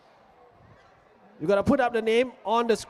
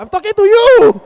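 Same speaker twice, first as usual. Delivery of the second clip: loud high call to the crowd; shouting across the room; 9 semitones higher, 13 dB louder than usual. Real, not acted. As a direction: yell it out to someone far back. Calling louder to the same parent.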